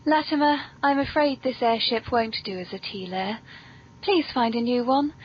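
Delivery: ordinary talk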